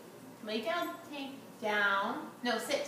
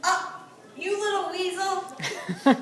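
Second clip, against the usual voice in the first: slow, laughing higher; 4 semitones higher, 5 dB louder, slower than usual. Real, not acted. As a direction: laugh through it at the dog. Laughing at a dog.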